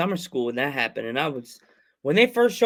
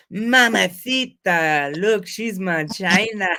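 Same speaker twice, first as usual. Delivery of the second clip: slow, stretched Eddie Guerrero voice; playful slow mock wrestler crooning; Eddie impression; 3 semitones higher, 3 dB louder, much slower than usual. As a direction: drag it out like a smitten wrestler. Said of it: "I would always go".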